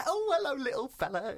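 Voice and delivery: put-on high, cooing baby-talk greeting; patronising greeting. People come up to the little fella, patronising. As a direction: coo it high as if to a tiny child. Strangers patronising a small man.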